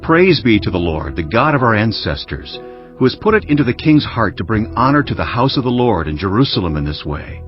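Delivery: normal talking voice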